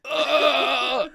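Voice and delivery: high, drawn-out "ugh"; imitated retch. Stranger on the ride looks at you and pukes, imitated retch. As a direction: heave like someone puking. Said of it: "and he's like".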